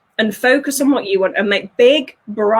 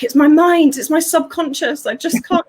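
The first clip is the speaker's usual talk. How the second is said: high, louder helpless whine; whiny old self; 6 semitones higher, 4 dB louder than usual. Retold or imitated. Her old self excusing herself, high and whiny. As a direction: plead like someone stuck in excuses. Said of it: "where I was like".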